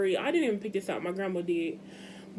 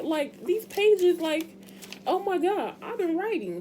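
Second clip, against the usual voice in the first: excited gush; 8 semitones higher than usual. Real, not acted. Gushing about her writing.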